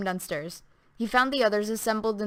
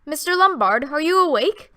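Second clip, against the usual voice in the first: calling through a door; 6 semitones higher, 6 dB louder than usual. A narrator's reading. Knocking to wake Lombard.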